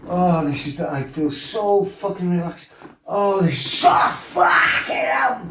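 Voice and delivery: mock dreamy stoner. Retelling his own stoned bliss before the snap. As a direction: float through it, dopey and blissed out. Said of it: "Gone from".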